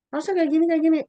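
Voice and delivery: high, loud mock lecturing; bossy friends. Friends lecturing her on what to do, high and loud. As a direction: rattle off orders like a know-it-all.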